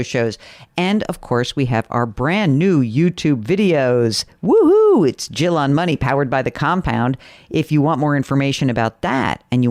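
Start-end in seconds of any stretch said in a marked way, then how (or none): 4.4-5.1 high, loud celebratory woohoo; a delighted yelp of celebration; excited whoop. Real, not acted. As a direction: cheer it out like a fan. Cheering the new YouTube videos.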